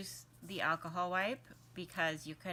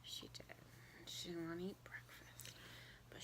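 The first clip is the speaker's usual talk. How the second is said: barely voiced, whispering; 17 dB quieter than usual. Real, not acted. Whispering near sleeping baby.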